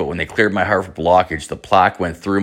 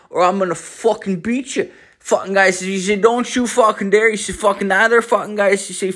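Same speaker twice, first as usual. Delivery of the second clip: put-on higher, fast, mumbled Newfoundland accent; thick Newfoundland accent; 11 semitones higher than usual. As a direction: rattle it off in a thick Newfoundland accent, swearing every word.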